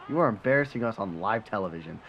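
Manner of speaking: normal talking voice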